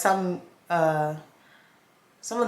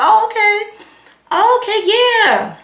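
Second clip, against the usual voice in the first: much higher, louder forced agreement; awkward; 13 semitones higher, 8 dB louder, slower than usual. Retold or imitated. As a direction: nod along, uncomfortable. Awkward reply when you can't talk.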